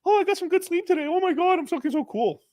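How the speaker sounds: high, silly mock voice; mock a smug slacker in falsetto; high-pitched sneering impression of a slacker; mocking imitation. Mocks people who sleep in.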